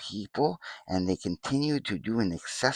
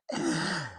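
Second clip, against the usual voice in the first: higher villainous giggle, falling away; mischievous giggle; 7 semitones higher than usual. Pretend. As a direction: gloat with a little laugh. Villain gloats over his schemes.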